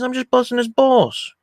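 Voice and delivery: high, slow mock protest; imitated self-defence. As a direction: play innocent, hammed-up. Acted protest of innocence.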